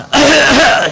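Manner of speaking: loud, high mock hacking cough; mock cough of a sick friend; imitated cough. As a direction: hack like a really sick man.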